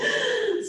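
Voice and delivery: high, quick real laugh; high burst of laughter. Real, not acted. ASL teacher laughing at her own full-stomach joke.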